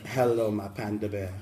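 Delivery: low, soft sweet-talk; trying on a smooth lover's hello; mock suave greeting. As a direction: drop deep and quiet for the pet name. Trying out smooth pet-name greetings.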